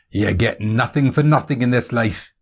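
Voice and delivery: knowing Scottish maxim; worldly Scottish voice; Scottish accent. Acted. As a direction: share hard-won wisdom in a Scottish accent. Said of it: "Is that a Scottish accent".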